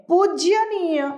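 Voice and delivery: पूजनीय said slow and high, falling; wrong form. Shown as a demonstration. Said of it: "गलत शब्द है".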